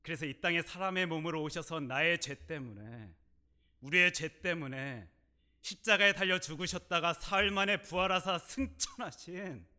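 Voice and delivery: preacher's swelling declaration; fervent confession. Retold or imitated. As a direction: build it up with conviction.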